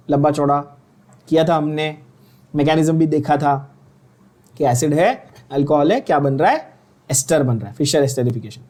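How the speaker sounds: normal talking voice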